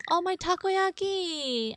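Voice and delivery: slow, high anguished cry; dismayed wail. Real, not acted. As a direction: wail over the lost food. Horror game, vomiting scene.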